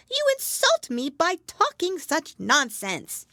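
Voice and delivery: high, loud, huffy mouse voice; offended mouse. A narrator's reading. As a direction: refuse in a huff as the mouse.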